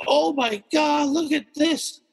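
slow, high, stunned; slow it down in disbelief; awed gasp. Retold or imitated. Watching raccoons fight in the pool, stunned and slow. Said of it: "And I was like".

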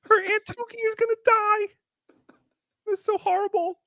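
high, squealing dismay; amused horror. Real, not acted. Host reacting to the psychic's blunt prediction, high amused horror. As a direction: squeak it out, scandalized by the joke.

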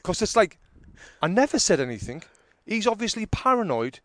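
loud, high exasperated retelling; getting angry. Retold or imitated. Comedian recalling being wrongly accused by driver, angry. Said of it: "I'm getting angry".

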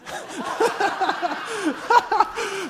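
real burst of laughter; high loud laugh. Real, not acted. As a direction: laugh it off, caught out. Laughing after story.

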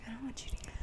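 hushed unvoiced whisper. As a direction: whisper it so nobody hears. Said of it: "I'm like".

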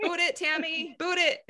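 high mom voice with laughter in it; imitated soccer-coach mom. Daughter imitating her soccer coach mom, laughing high-pitched urging. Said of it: "she was my soccer coach growing up".

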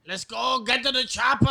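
high mock shout; hammed-up action hero yelling an order; movie hero impression. Mock movie quote, wrong movie.

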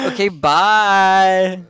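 drawn-out bye. Pretend. Silly sign-off.

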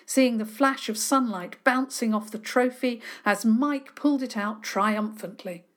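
usual speaking voice

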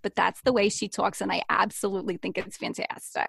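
put-on New Jersey accent. Showing how a woman from New Jersey talks, New Jersey accent. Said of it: "from New Jersey talks like this".

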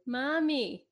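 put-on mild high child's call of Mommy. Kid calls to mom who won't answer, lightly high child voice. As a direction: call out Mommy like a small kid wanting attention. Kids calling their silent mom.

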